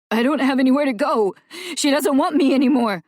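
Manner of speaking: loud, high, rushed panic; boy panicking to Celia; panicked sobbing. Acted. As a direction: blurt it out between gasps.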